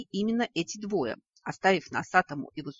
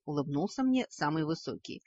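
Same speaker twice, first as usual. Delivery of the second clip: usual speaking voice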